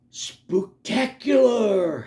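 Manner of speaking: high, loud and stretched out; drawn-out spooky cheer; gleeful exclamation. Acted. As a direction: savour the word slowly, with relish.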